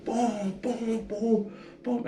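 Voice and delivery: high, quick booms for each punch; mock punch sounds. Recounting the jail fight, he mimics each punch with booms. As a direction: beat out the blows with your voice.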